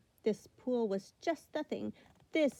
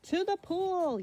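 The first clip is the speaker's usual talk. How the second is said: high yelled order; 9 semitones higher, slower than usual. Acted. Fireman yells the way to his men. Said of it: "yelled the fireman".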